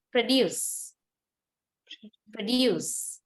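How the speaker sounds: "produce" said twice, each with a heavy DUCE; light PRO, lean on DUCE in "produce"; verb stress on second syllable. Shown as a demonstration. Teacher gives the verb meaning, second-syllable stress on "produce". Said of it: "the second syllable is much more heavier".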